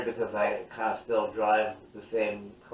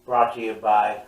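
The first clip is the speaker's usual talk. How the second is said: announcer voice; 5 dB louder than usual. Acted. Mock breaking news read.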